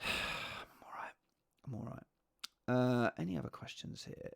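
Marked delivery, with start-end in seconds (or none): 0.0-2.0 whisper. Real, not acted. Quiet reply to a transfer suggestion.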